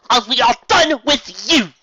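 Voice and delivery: high, loud, slow final words; cold dismissal. Acted. Rarity dismissing Fluttershy, loud slow voice. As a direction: shut the door on her.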